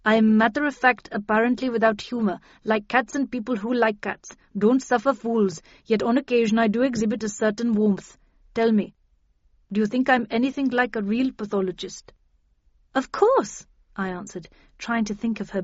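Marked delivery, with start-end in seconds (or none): 0.0-12.1 matter-of-fact doctor listing her traits; lightly accented Indian pathologist; Indian woman pathologist. Acted. 12.9-13.6 high-pitched, quick-to-please assurance; overeager reassurance. A narrator's reading. Narrator hastily reassures the anxious pathologist.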